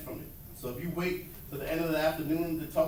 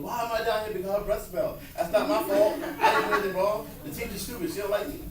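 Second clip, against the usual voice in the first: higher, louder, aggrieved protest with a laugh; indignant kid; 5 semitones higher, 5 dB louder than usual. Retold or imitated. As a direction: play a sulky kid who thinks it's unfair. Kid complaining after being sent out.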